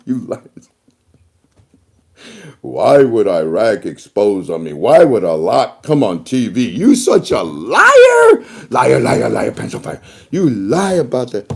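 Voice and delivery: loud mock accusations, liar slowed; hammed-up scolding; loud mock tirade; mock angry rant. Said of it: "And he says".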